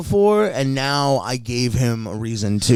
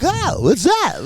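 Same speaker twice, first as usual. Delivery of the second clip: high, slow clownish innocence; mock Krusty the Clown; 13 semitones higher, slower than usual. Acting clueless to the woman, like Krusty the Clown. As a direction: play dumb like a cartoon clown. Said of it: "Looking ... like fucking Krusty the Clown".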